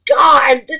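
slow, high, loud, near-tearful cry; overwhelmed cry. Real, not acted. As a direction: let it out in awe, stretched long. Seeing the finished jar.

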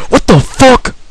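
very high incredulous yell; squealed, indignant outburst; outraged shock. Real, not acted. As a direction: explode in disbelief. Shocked at game event.

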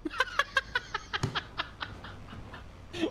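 long high wheezy laugh; real helpless laughter. Real, not acted. Laughs at the grenade idea.

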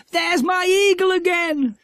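very high, loud, thrilled shout; excited cry. A narrator's reading. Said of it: "cried Jack, suddenly in excitement".

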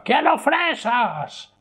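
very high, slow possessed shriek; mock demonic scream of the demand; Exorcist girl. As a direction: burst back to life like a possessed child. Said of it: "resucita como la niña del exorcista".

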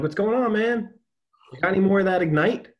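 high, quick hyped-up bro; bro greeting. Retold or imitated. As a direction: call it out like a gym buddy. Greeting a fitness guy.

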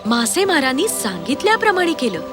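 ordinary delivery, nothing marked

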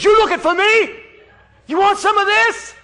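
loud, high, swaggering taunt; cocky challenger. Acted. Young Abishai taunting the giant, loud and cocky. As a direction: taunt him like a street fighter.

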